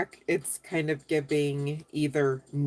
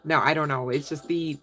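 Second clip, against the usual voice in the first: usual speaking voice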